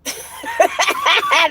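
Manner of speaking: very high real laugh bursting out; squealing with laughter. Real, not acted. Amused by her ex's texts, squealing with laughter.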